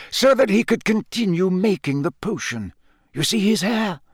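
lightly voiced kindly old wizard; gentle, knowing old professor; wise old headmaster. Dumbledore voiced by narrator.

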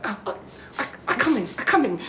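imitating a woman climaxing; mock moaning woman. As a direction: ham up her moaning.

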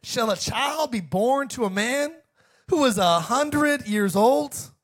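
high, drawn-out disbelieving question; hammed-up biblical incredulity; incredulous Abraham. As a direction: ask it slowly, as if it is absurd. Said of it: "Abraham has a good sense of humor".

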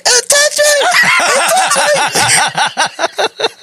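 very high, drawn-out squeal; hammed-up hysterical whimpering shriek; mock screaming. Shaken kid telling others it touched him, squealing. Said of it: "I screamed really, really loud like a bitch".

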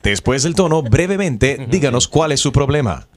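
steady automated recorded-message voice; phone menu voice. Acted. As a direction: read it like a robotic phone menu.

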